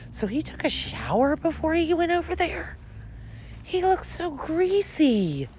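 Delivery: high mocking rant. Real, not acted. Mocking the boyfriend's photo, high-pitched gossip. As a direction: pitch it up in scandalized glee.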